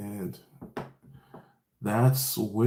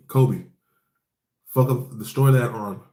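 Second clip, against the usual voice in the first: louder barked order to a recruit; commanding order; 5 dB louder than usual. Retold or imitated. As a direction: call it out like a commander. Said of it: "he's like".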